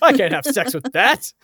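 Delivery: high, loud put-on voice of an appalled man; hammed-up outraged protest; mock outrage. Jokes as a horrified man.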